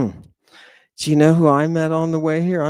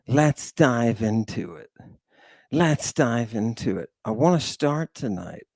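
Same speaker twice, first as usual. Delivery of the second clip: ordinary delivery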